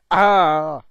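sudden loud "ah" cry; loud frustrated yell. Real, not acted. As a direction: yell out at the game. Frustrated outburst while playing.

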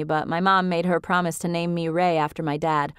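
ordinary delivery, nothing marked

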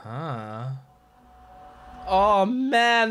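normal talking voice

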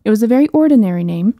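ordinary delivery, nothing marked

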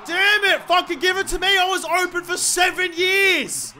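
loud, high-pitched exasperated complaint; real angry gamer outburst; frustrated outburst. Real, not acted. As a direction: yell at a teammate who ignored you, dragging out the end. Not passed to while open.

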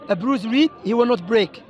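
normal talking voice

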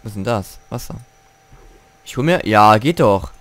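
ordinary delivery

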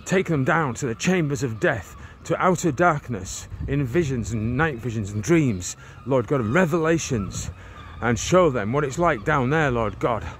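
ordinary talk, nothing marked

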